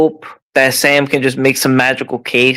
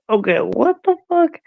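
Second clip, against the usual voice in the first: disbelief; 4 dB quieter than usual. Real, not acted. Laughing in disbelief at text.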